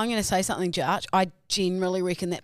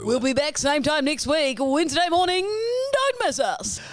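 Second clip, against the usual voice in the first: announcer voice; 8 semitones higher, 3 dB louder than usual. Acted. Playful outro sign-off.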